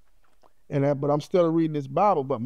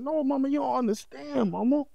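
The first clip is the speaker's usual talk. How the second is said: insistent plea; 10 semitones higher than usual. Retold or imitated. Son insisting to his mama that she understand, higher and pleading. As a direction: beg her to understand.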